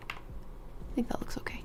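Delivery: breathy off-mic whisper, slow; real whisper. Real, not acted. Streamer checking her setup, whispering to herself. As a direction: whisper it to yourself while fiddling with settings. Muttering while setting up recording.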